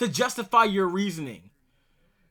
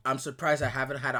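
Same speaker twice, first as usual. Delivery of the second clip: normal talking voice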